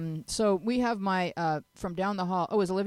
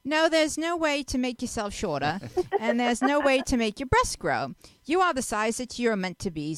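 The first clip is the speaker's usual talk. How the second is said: put-on British accent; 2 semitones higher than usual. Host reading Jenny's advice column reply in an amateur British accent. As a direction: fake your way through a vague English accent. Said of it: "I don't know what region of England I was from".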